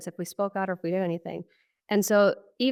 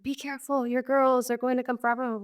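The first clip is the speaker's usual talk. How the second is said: higher-pitched retold warning from a passerby; lightly acted warning in a raised pitch; imitating passerby's warning; 6 semitones higher than usual. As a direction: relay a stranger's urgent caution, pitch lifted. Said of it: "someone running past my friends and I and being like".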